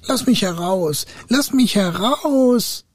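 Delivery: high, drawn-out plaintive call; high trapped-spirit cry; muffled trapped spirit. A narrator's reading. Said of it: "rief's von neuem".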